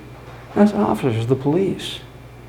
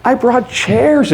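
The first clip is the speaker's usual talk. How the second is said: much higher and louder, gleeful; manic triumph; 8 semitones higher, 5 dB louder than usual. Acted. Narrator showing police the house, manic and triumphant. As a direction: crow it out, giddy with confidence.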